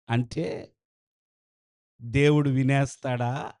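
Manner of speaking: ordinary talk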